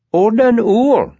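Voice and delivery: put-on high, slow, scolding boy voice; a small boy gently correcting adults; reproving child. A narrator's reading. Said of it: "he said reprovingly".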